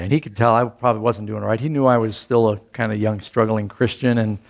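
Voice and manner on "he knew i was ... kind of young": ordinary delivery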